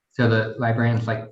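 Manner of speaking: ordinary delivery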